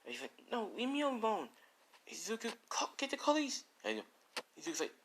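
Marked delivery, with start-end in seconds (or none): 0.5-3.6 much higher pleading woman's voice; panicked plea to the boy; woman in distress. Acted. Woman grabbed by thugs begs Izuku for police, high and panicked.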